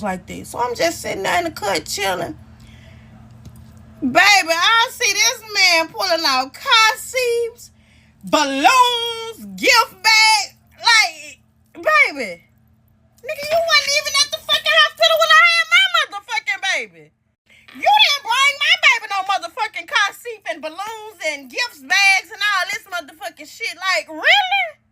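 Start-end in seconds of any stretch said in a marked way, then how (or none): 4.0-12.4 higher, slow, voice near tears; tearful slow listing; choked up. Real, not acted. Sees him bring gifts. 13.2-20.1 very high, fast, hurt fury; rapid high wounded rage; furious outburst. Real, not acted. Outburst at the father. 23.8-24.8 high, slow, drawn-out really; hurt incredulous really; bitter disbelief. Real, not acted.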